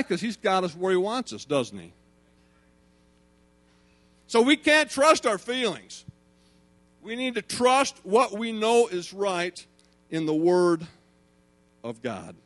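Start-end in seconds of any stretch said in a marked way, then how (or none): 4.3-12.3 loud, emphatic sermon climax; impassioned preaching. Real, not acted. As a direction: proclaim it forcefully, leaning on each key word.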